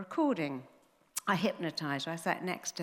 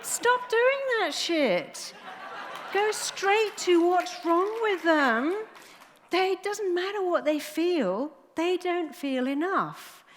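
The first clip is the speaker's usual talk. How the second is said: much higher and louder, slow, laughing emphatic; passionate outburst; 11 semitones higher, 5 dB louder, slower than usual. Real, not acted. Urging psychiatrists to stop, high loud laughing fervour. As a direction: hammer it home with laughing fervour.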